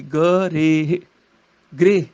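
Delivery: ਗ ਰੇ split, then blended as 'greh'; sounded out. Shown as a demonstration. Teacher spelling it out, ਗ ਰੇ then 'greh'. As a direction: break ਗ੍ਰਹਿ into 'ga' and 'reh'. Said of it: "ਸਿਆਰੀ ਰਾਰੇ ਨੂੰ ਲੱਗੇਗੀ".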